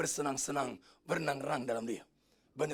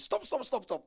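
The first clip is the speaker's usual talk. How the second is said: raised, higher rapid-fire command; king's mock yell; 4 semitones higher, 4 dB louder, slower than usual. English king halting the torture, retold yell. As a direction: halt them urgently with repeated calls. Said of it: "Raja Inggris berteriak begini".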